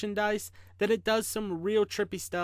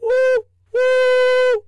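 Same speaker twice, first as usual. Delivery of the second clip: very high, loud train whistle; long, piercing "woo woo" toot; big whistle noise; 12 dB louder than usual. A narrator's reading. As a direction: hoot like a locomotive across the plains. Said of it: "the whistle made its big noise".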